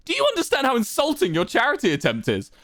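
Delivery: high-pitched offended protest; indignant anger. A narrator's reading. Wilbur protesting a charity offer, indignant. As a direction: flare up, deeply insulted. Wilbur, insulted, argues back.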